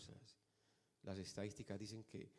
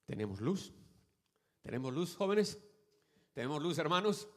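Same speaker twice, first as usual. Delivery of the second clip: loud rising questions, each more insistent; rousing call-out; 6 semitones higher, 12 dB louder than usual. Real, not acted. Preacher challenging young people and brethren, rousing loud call. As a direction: demand an answer from the crowd. Preacher calls congregation to respond.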